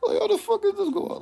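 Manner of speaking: stunned coworker discovers everyone smokes; high laughing disbelief. Retold or imitated. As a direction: squeal it out half laughing, stunned. Manager walks past.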